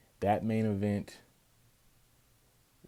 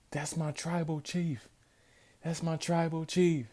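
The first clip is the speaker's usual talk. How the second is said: high, fawning praise of the chief; mock devotion; 9 semitones higher than usual. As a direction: gush over your leader. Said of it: "he tells him".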